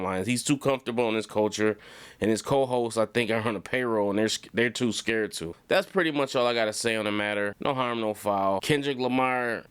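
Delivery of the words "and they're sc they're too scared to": ordinary delivery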